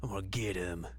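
hammed-up sulky muttering; mock angsty whisper. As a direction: whisper like a dramatic teenager. Voicing angsty Koga.

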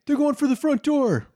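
high shouted alert; high voiced warning cry; guard calling out. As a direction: call it out to the other guards.

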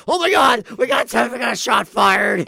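high, loud panicked shout; mock frantic. Imitating Saint Paul cops beside calm Minneapolis, frantic. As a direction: overplay total panic over one shot. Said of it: "how frantic they are".

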